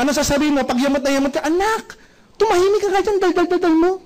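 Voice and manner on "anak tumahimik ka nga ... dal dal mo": higher-pitched exasperated mum voice; annoyed parent scolding. Retold or imitated. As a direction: scold the noisy kid, fed up.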